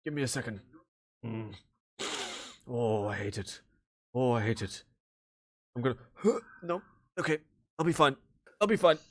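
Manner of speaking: shaky, half-gasping, mildly nauseous; fighting down the sickness from teleporting; queasy after teleport. Acted. Father just teleported, queasy and gasping.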